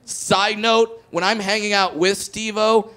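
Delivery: ordinary talk, nothing marked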